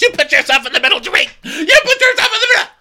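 high, loud, rising mock shout; mock yell. Kyle shouting at Dorit, building to a loud shout. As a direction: build to a shrill yell at her. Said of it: "And Kyle goes".

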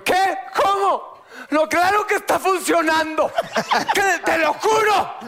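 high, laughing mock plea that it works; desperate pleading. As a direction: beg her in a hammed-up high voice, half laughing.

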